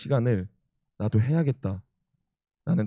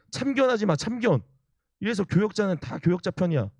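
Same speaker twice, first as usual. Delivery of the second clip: mock angry yell; 9 semitones higher than usual. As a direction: snap back as an indignant elder, pitch jumping high. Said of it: "그래서 막 소리를 지르고". (화내는 장로 흉내, 높게 쏘아붙이듯)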